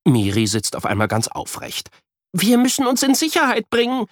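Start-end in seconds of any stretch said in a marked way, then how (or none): 2.3-4.1 put-on high urgent girl voice; high eager girl voice. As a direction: urge him to flee. Miri urges escape.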